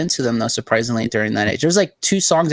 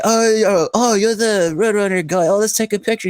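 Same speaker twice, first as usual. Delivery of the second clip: high, slurred British rocker voice; woozy mock-British drunk, pitched up; drunk British guitarist; 7 semitones higher than usual. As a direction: imitate a plastered Brit, slightly more coherent. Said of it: "he has a thick British accent".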